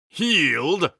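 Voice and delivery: triumphant shriek; knight's savage scream. A narrator's reading. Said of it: "the Blue Knight screamed".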